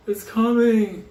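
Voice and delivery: high, loud, stretched-out holler; mock warning cry. Someone warning people of the storm, mock alarm yell. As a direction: imitate someone calling out an alarm. Retold crowd fleeing a storm.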